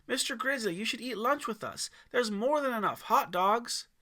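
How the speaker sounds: put-on boy shouting an eager invitation. A narrator's reading. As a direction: call the invitation out excitedly. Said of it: "Aiden shouted".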